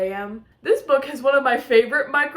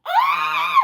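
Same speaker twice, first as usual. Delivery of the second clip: delighted shriek. Real, not acted. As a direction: shriek with joy. Another squeal over confession.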